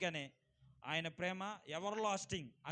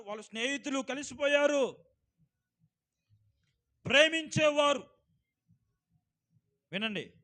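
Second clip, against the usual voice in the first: loud, ringing pulpit voice; fervent sermon delivery, raised and loud; preaching at full force; 6 semitones higher, 11 dB louder than usual. Acted. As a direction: preach it out to the whole hall.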